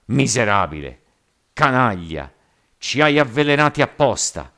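very loud, furious insults; roaring mob. A narrator's reading. Rioters accusing the captain of poisoning, roaring. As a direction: roar each accusation at him. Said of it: "Un immenso clamore scoppiò".

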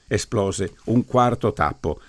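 ordinary delivery

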